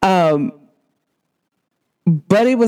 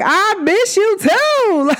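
tender reply; 13 semitones higher, much slower than usual. Retold or imitated. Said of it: "And I'm like".

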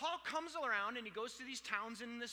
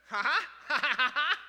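loud, high "ha ha" of triumph; triumphant laugh; 7 semitones higher, 17 dB louder than usual. Real, not acted. Preacher pleased at linking whiskey to Galatians, triumphant laugh. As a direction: burst out in a big, pleased laugh.

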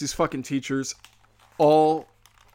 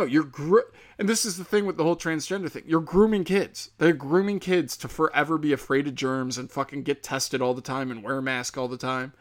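normal talking voice

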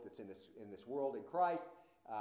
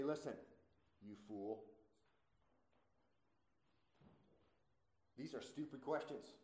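understated, softly delivered put-down; blunt rebuke; 7 dB quieter than usual. Acted. Preacher voices Paul answering mockers, quietly blunt. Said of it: "Paul is being blunt".

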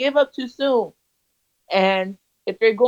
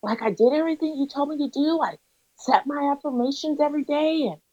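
excited; 6 semitones higher than usual. Retold or imitated. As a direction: gush the good news to a mentor, reenacting it. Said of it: "I was so excited".

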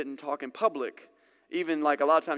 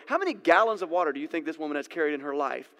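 ordinary talk, nothing marked

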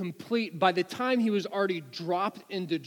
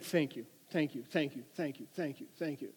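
soft, low, repeated thanks trailing away; meek gratitude; 4 semitones lower, 9 dB quieter than usual. Retold or imitated. Driver thanking the trooper for mercy, meekly grateful. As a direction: act out humbly thanking an officer under your breath.

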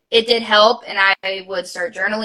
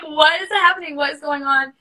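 retold sobbing; 5 semitones higher than usual. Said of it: "I just started bawling my head off".

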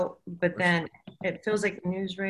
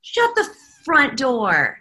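loud, drawn-out, higher-pitched astonishment; astonished disbelief; 4 semitones higher, 6 dB louder, slower than usual. Retold or imitated. Medium retells reaction to eight marriages, astonished. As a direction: stretch it out in stunned disbelief.

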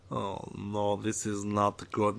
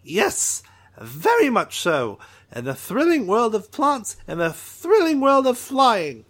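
excited alien Trixel; 7 semitones higher, 3 dB louder than usual. Acted. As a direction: greet him like an overeager little alien. Drawl loves thrills.

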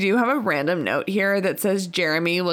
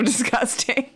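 ordinary delivery

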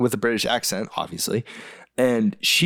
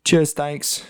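higher, put-on British thanks; mimicked British accent; 4 semitones higher than usual. Co-host copies the phrase in a British accent. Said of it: "but I would always go".

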